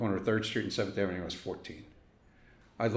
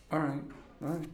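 mock fright; 9 semitones higher than usual. Mock scared stranger hearing Brooklyn.

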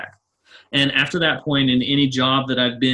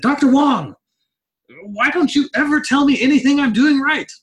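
loud, high frustrated outburst at the teacher; retold holler; 14 semitones higher, 5 dB louder than usual. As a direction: yell it out, fed up with only hearing what's wrong. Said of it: "And I actually hollered at him".

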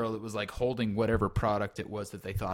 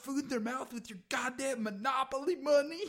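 mock ranting; 13 semitones higher, faster than usual. Host ranting at guest.

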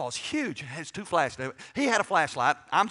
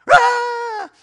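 mock high-pitched girlish scream; loud, piercing imitation of a screaming girl; little girl's scream; 12 dB louder than usual. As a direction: shriek like a terrified little girl. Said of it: "the screams of a little girl".

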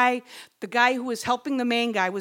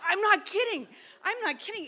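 insisting; 9 semitones higher than usual. Retold or imitated. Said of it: "I said".

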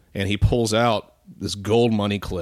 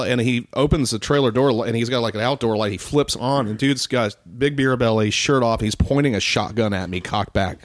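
ordinary delivery

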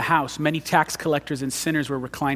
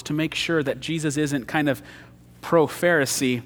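ordinary talk, nothing marked

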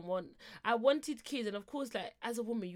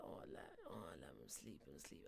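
whispered half-asleep mumble, much lower; sleepy whisper; 19 dB quieter than usual. Retold or imitated. Sleepy boyfriend whispering down the phone. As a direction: breathe it out like you were woken up.

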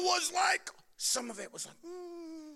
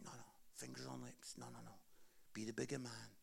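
God hushing; 10 semitones lower, 13 dB quieter, faster than usual. Retold or imitated. God calming the speaker.

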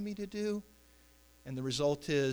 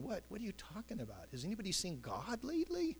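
softer, higher incredulous questioning; puzzled David; 6 semitones higher, 8 dB quieter than usual. Acted. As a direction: ask it quietly, baffled by everyone's panic. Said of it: "I imagine David's going".

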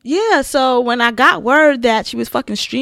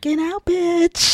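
ghost voice; 7 semitones higher, much slower than usual. Pretend. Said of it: "my ghost would be".

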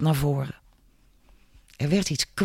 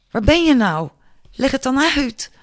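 high-pitched, pleading call; calling after someone; 10 semitones higher, 3 dB louder than usual. A narrator's reading. Julia searches for vanished Mark, calling high. Dreaming girl calls after friend.

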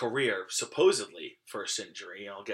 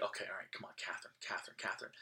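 hoping whisper; 4 semitones lower, 13 dB quieter, much faster than usual. Retold or imitated. Kid pleading for school closing.

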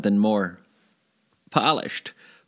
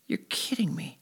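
stunned whisper; 7 semitones higher, 9 dB quieter than usual. Retold or imitated. Stunned thought about his nickname.

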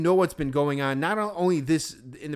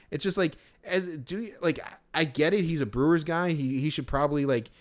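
normal talking voice

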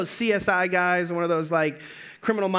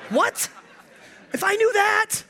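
high, incredulous comeback; mock exasperation at a silly question; exasperated; 11 semitones higher than usual. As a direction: scoff like it's obvious. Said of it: "I'm gonna be like".

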